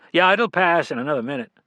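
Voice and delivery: a hint of a wary stranger cutting it short; curt male stranger. A narrator's reading. Narrator voices the stranger.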